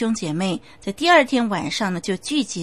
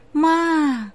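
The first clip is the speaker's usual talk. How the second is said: put-on high, drawn-out call of a child spirit; calls out thin and eerie from above; ghostly child call; 7 semitones higher, 4 dB louder than usual. A dead child's voice calls to his mother, eerie and high.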